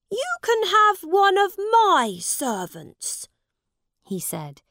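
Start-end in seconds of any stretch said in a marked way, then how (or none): 0.1-3.3 high, loud princely voice; proud prince handing out favours; generous young prince. Acted. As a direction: offer it grandly, loud and high. Prince offers Tom a servant.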